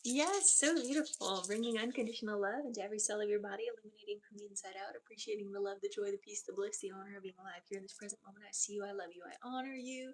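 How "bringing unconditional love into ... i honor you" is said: soft meditation blessing; guided blessing. Acted. Medium blessing the client at session end, soft and tender. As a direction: bless them gently and lovingly.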